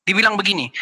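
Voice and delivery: ordinary talk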